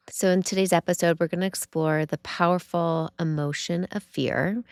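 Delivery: ordinary delivery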